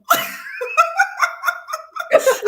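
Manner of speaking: big high laugh; cracking up at her own joke; loud burst of laughter. Real, not acted. Laughing hard at her Yoruba warning, loud high laugh.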